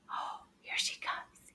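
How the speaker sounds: breathy hush before the shy friend peeks out; hushed whisper. Acted. Teacher whispering to children as a shy friend arrives. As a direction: whisper it slowly so she won't be startled.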